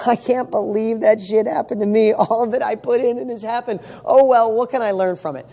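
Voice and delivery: acted-out cheerful disbelief; laughing it off. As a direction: shrug off the setback with mock amazement. Same setback, laughed off.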